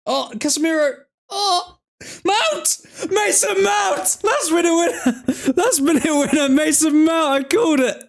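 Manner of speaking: screaming high with joy and disbelief, laughing through it; shrieking, laughing celebration of a last-minute winner; ecstatic goal celebration. Real, not acted. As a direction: lose it completely as the winner goes in.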